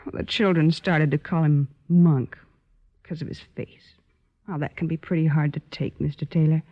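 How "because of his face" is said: barely voiced, the words almost breathed; whispered. Sister telling the officer of her brother's shame, in a near whisper. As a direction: let the shame drop it to a whisper. Sister tells of cruel nickname.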